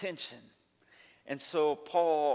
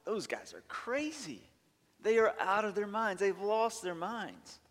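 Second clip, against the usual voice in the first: scoffing onlookers; 7 semitones higher than usual. Retold or imitated. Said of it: "people were looking at them and going".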